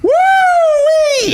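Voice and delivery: loud, high cheering whoop; excited whoop. Real, not acted. Fan recalling a wild fight, whooping loudly.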